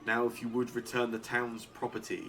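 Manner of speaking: stately town mayor, measured and lofty; lightly voiced mayor. GM voices the mayor addressing Carmen.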